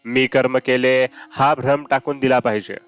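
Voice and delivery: ordinary talk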